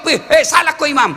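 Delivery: high mock shout at the imam; mock shouted correction; rude shout. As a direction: snap at the imam.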